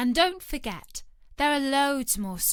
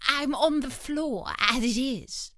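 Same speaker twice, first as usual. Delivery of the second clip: slow, plaintive Hatter; a meek hatter explaining himself; Hatter's voice; 4 semitones higher than usual. A narrator's reading. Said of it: "said the Hatter".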